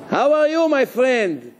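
high, bright-pitched friendly hello; friendly greeting. Acted. Menashe greeting the rabbi in a dream, mock friendly. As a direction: greet him like an old buddy.

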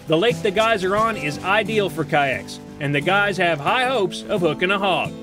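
ordinary talk, nothing marked

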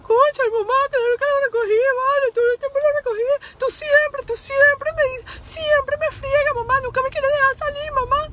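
high, loud whiny mock sobbing; fake crying teen. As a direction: overplay a teen's dramatic fake tantrum. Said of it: "ni una lágrima botaba ... puro show".

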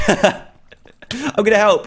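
slow laughing through words. Real, not acted. Laughs while joining the fight.

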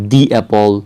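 The apple, "The" a long "dee". Shown as a demonstration. Teacher recapping examples for learners, "dee apple". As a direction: stretch "The" to "dee" before apple. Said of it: "যেমন এটার ক্ষেত্রে কী ছিল?".

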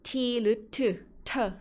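"t" sounded as "thue", then "เธอ"; t sound demonstrated. Shown as a demonstration. Teacher showing learners the -ed ending, "t" sound demonstration. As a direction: show the "t" ending as an aspirated Thai-style syllable. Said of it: "การออกเสียง t".

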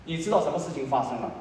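ordinary delivery

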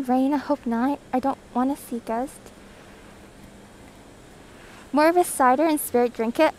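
ordinary delivery

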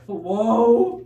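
usual speaking voice

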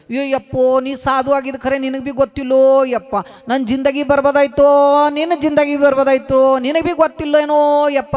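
high, loud wailing to the holy man; wailing complaint. Acted. Kabir wails his woes to the sadhu, loud and high. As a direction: cry out his ruin. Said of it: "ಅಂತ ಅಳಕ್ ಶುರು ಮಾಡ್ದನು".

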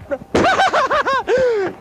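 high whooping laugh; big cackling laugh. Acted. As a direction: let out a big showbiz cackle. Laugh after pitch.

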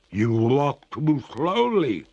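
slow, high, loud ghostly complaint; distressed water ghost. Acted. Freezing ghost chides the master, high and slow.

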